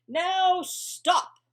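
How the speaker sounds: high, loud stop order; firm command. A narrator's reading. Max ending the rumpus, high loud command. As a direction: shut the party down. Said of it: "said Max".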